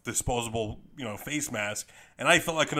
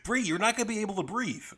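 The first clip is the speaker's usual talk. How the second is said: laughing; 9 semitones higher, faster than usual. Real, not acted. Friend laughs at mask plan.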